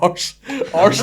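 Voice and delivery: put-on high, sing-song kid voice; a kid savouring a new swear; gleeful child repeating. Son repeating a rude word, gleeful child voice. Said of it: "he's like".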